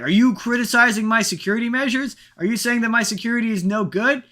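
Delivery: high, affronted mock warden; indignant warden. Imitating the warden snapping at Doctor Loomis, high and indignant. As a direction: ham up a warden whose pride is wounded. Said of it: "the warden is like".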